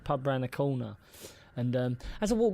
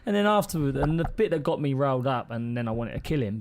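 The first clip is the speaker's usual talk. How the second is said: ordinary talk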